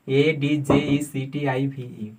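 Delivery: slow letter-by-letter spelling; spelled out. Shown as a demonstration. Teacher spelling a word slowly for students. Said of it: "spelling out for students".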